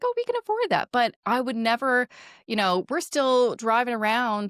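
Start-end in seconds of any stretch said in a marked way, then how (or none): 0.0-0.9 high-pitched, breezy quote; breezy realization. Retold or imitated. Quoting herself and husband now they can afford outings, breezy and high. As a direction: pitch it way up, light and carefree. Voicing couple's newfound financial ease.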